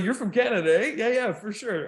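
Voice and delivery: high, lively Canadian retelling. Retold or imitated. Friend retelling meeting a fellow Canadian, exaggerated Canadian. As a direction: lean into the Canadian "eh". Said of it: "You're making it Americanized now".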